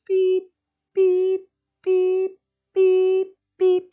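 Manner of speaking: high, even truck-reversing beeps. Shown as a demonstration. Teacher voicing a reversing truck for the class, high beeps. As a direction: beep like a truck backing up. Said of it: "And then reverse".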